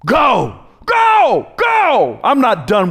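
God sending Elijah out, shouted; commanding shout. Acted. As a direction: drive him out the door. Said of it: "He said".

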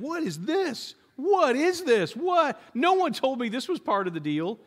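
high-pitched, indignant outcry of disbelief; mock shocked surprise. Preacher mimics believers surprised by persecution, high mock disbelief. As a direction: act out bewildered protest, pitch jumping up. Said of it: "we're surprised when we face persecution".

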